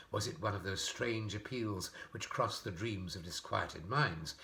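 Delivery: ordinary delivery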